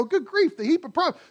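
high exasperated sigh of a reader. Retold or imitated. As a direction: throw up your hands at the mess. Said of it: "you sit here and go".

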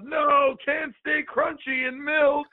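high, slow whiny superhero cry; hammed-up cartoon hero voice; mock cartoon yell. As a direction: wail it like a cartoon hero dunked in milk. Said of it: "Powdered Toast Man yells".

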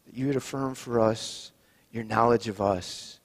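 closing prayer. Acted. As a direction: pray it gently to the Lord.

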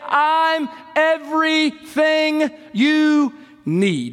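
drawn-out climactic preaching shout; preacher's shout. Acted. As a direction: build it to a full-force cry.

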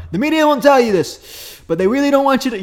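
high, loud rally voice; Trump impression. Host does Trump at rally, high loud voice. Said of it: "the really heavy breaths".

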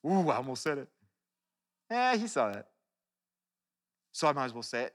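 low, soft acted inner voice; private thought. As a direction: play thinking aloud to yourself. Acting out thoughts of holding words back.

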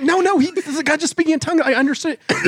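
high-pitched flustered insistence; frantic retold protest. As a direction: re-enact pleading to be believed. Retelling his frantic protest.

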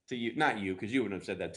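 normal talking voice

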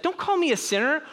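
mock-defensive, high-pitched objection; defensive pushback. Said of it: "push back against that".

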